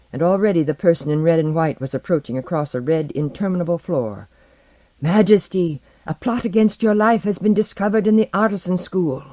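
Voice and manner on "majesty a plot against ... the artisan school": high-pitched, insistent announcement; urgent official report. Acted. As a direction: report it to the ruler on one high note. Official announces plot to the king.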